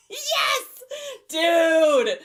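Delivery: high, loud burst of glee; excited shout. Real, not acted. Excited cheer over the tangent.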